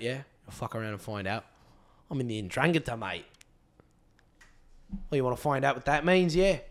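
put-on lightly done Australian accent, low and quiet tough-guy threats; a half-hearted Australian gangster act, menacing but soft; mock Australian thug. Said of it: "What does that sound like?".

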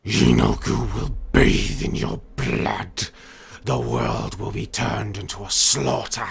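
slow, loud demonic threat; menacing creature. Acted. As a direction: draw out each word like a monstrous war cry. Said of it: "threatening him menacingly".

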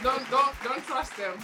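normal talking voice

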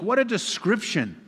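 high, amazed cry of wonder; awed exclamation. Real, not acted. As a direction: marvel at it aloud. Preacher exclaims in awe.

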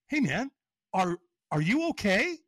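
imitating a stranger checking in, drawn out; retold concerned check. Stranger asks if he is okay, retold concern.

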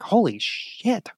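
slow, breathy holy shit; stunned and drawn out; awestruck gasp. Retold or imitated. Stunned reaction while playing.

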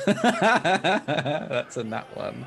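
slow words through laughter; laughing at a bad roll. Real, not acted.

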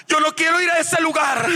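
high-pitched pleading shout; desperate cry. Retold or imitated. Preacher re-enacts begging the Lord above hell, desperate shout. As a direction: cry out to be spared. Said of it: "yo le grité al Señor".

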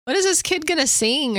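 high-pitched mock wondering; imitated doubtful teenagers. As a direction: lightly act out skeptical teens. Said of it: "these teenagers were probably like".

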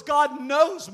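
loud pulpit emphasis. Real, not acted. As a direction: hit it harder the second time. Repeating the line for emphasis.

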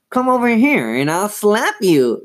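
high squeaky dummy threat; Slappy. A narrator's reading. Dummy threatens to slap Chris, high puppet voice. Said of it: "Lindsay made him say".

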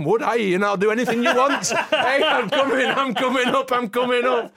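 high and loud, laughing with excitement; giddy eager acceptance. Retold or imitated. Starstruck fan accepts Botham's invite, giddy and laughing. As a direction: gush yes like a starstruck fan.

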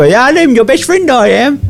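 high Cockney geezer toy voice, said I am your best friend. Retold or imitated. Retelling the toy voice again to a mate, high Cockney toy. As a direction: squeak out the toy catchphrase in Cockney. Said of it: "He kept pressing, he go".

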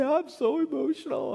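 high-pitched imitation of his emotional daughter; daughter's line. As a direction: squeak it like a dramatic kid. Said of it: "But she'd say".

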